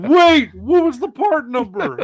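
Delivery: high, loud mock yell; urgent caller. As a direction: cry out to stop someone hanging up. Caller urgently stops him hanging up.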